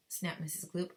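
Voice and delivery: ordinary delivery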